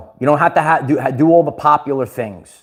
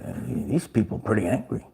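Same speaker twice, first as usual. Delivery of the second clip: hushed aside; 5 semitones lower, 11 dB quieter than usual. Retold or imitated. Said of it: "I said".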